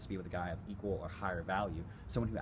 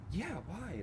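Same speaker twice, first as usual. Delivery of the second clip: much higher, lightly voiced girl asking why; girl defensive question; 11 semitones higher than usual. Coach voicing a girl caught off guard, higher. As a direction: play her as a bit thrown.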